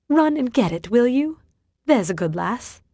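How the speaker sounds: a hint of a condescending gent; patronizing Theodore. A narrator's reading. Theodore sending Lucy for the key, patronizing.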